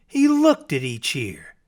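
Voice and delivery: ordinary delivery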